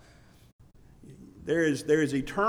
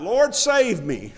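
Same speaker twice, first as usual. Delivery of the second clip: drawn-out, louder, higher plea; crying out for help; 4 semitones higher, 5 dB louder, much slower than usual. Retold or imitated. Peter calls to Jesus as he sinks, crying out. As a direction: call out slowly, raised in an imitated cry. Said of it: "He cried out".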